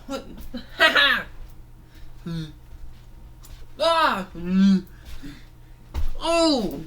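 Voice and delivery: high, loud mocking voice with laughs; taunting toy fighter. Toy fighter gloats at his attackers, high laughing taunts. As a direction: gloat over the beaten foes.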